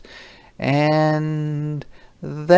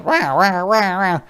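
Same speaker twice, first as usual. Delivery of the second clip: siren sound; 8 semitones higher than usual. Acted. Siren noise in story.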